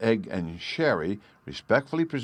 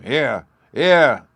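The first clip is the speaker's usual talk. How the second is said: loud, higher crowd chant; rumbling crowd; 5 semitones higher, 8 dB louder than usual. A narrator's reading. The crowd drowns out the orator, loud cheering. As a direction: boom it like a whole crowd. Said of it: "went rumbling round the marketplace".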